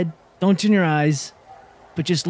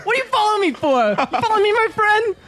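much higher put-on voice, mock-tough street challenge; tough guy confrontation. Player's character confronts the tiny tail guy, high mock-tough voice. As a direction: squeak it out like a cocky punk squaring up.

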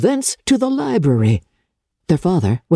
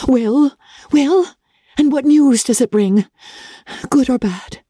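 higher, eager, pressing questions; 8 semitones higher than usual. Acted. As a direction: urge him on impatiently. Elizabeth presses father eagerly.

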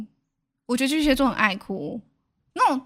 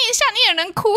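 mom teasing; 10 semitones higher, much faster than usual. Retold or imitated. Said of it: "我妈傻眼。".

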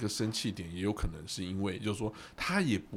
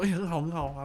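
pleased; 9 semitones higher, 5 dB louder than usual. Retold or imitated. Pleased with her plan.

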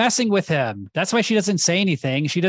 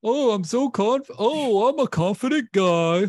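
mock self-impression; 6 semitones higher, slower than usual. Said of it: "you're doing an impression of yourself".

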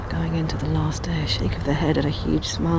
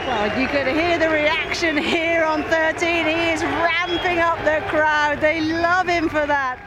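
still excited; 11 semitones higher than usual. Real, not acted. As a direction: stay pitched up, riding the crowd's noise. Commentator after the chip-in.

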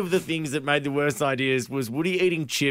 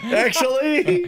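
mocking; 10 semitones higher, much slower than usual. Real, not acted. Mocking repeat of co-host's word.

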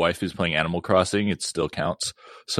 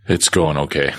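sultry low voice; 6 semitones lower than usual. Real, not acted. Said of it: "Apparently, apparently my voice has dropped".

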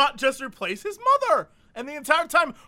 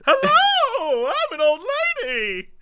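much higher, louder mock granny; young actress faking an old woman; fake old lady; 13 semitones higher, 5 dB louder, slower than usual. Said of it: "this twenty-five-year-old actress".

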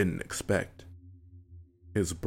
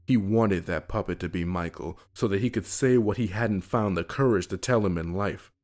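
ordinary delivery

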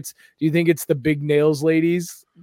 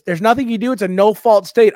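high, louder mock-grandma voice; 7 semitones higher, 7 dB louder than usual. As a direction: imitate grandma insisting, pitched up. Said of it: "Grandma right then calls and goes".

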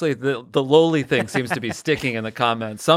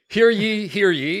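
town crier; 7 semitones higher, slower than usual. Acted. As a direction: announce it like a herald. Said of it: "Let me bring court into session".

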